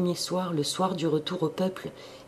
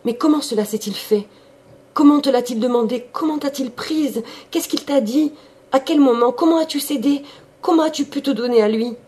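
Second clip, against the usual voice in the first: rushed, much higher and louder questions; hurried questioning; 10 semitones higher, 8 dB louder than usual. A narrator's reading. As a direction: fire the questions at her, breathless. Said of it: "interrogea d'une voix précipitée".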